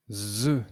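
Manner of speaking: each syllable sounded out clearly; teacher voicing syllables one by one; syllable drill. Shown as a demonstration. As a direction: model each syllable for the class. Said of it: "nous allons prononcer les syllabes".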